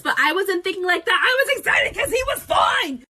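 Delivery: rising to a high shout; worked-up, high-pitched comic outburst; exclaiming in self-defence. Real, not acted. Defends herself at story's climax.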